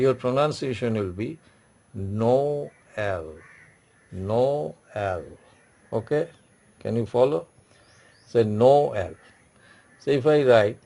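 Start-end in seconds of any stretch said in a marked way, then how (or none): 1.9-5.2 "no", a held pause, then "L"; pause inside Noël. Shown as a demonstration. Teacher showing students the French tréma, "no", pause, "L". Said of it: "pause for a second". 8.4-9.1 "no L" as two separate parts; pause inside Noël. Shown as a demonstration. Teacher asking students to follow, "no L" in two parts. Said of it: "then you pause for a moment".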